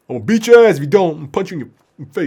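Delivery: fast, tumbling pretend threat; mock threat. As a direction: rattle off threats in a put-on voice. Mock threat to struggling patient.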